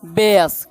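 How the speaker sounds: बेस low, with a long "ay"; pronunciation demo. Shown as a demonstration. Teacher showing how it is spoken, low बेस as "bayss". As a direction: model बेस as "bayss", one syllable. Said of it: "क्या बोलेंगे".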